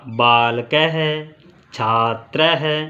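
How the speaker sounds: बालक है छात्र है, each word drawn out slowly with है breathed at the end; very slow, spelling out the final है sound; visarga demo. Shown as a demonstration. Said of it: "है का उच्चारण लास्ट में आपको प्रतीत होगा". (धीरे-धीरे, अंत में है का उच्चारण)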